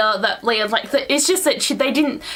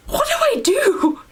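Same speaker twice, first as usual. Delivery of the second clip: gasp, then a high wail; hammed-up panicked squeal; mock panic; 13 semitones higher than usual. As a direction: imitate someone helplessly panicking. Acting out panicking man at birth.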